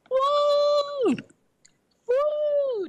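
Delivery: high squeaky cork-pop woo; champagne cork pop. Pretend. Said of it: "I love making the champagne cork popping sound".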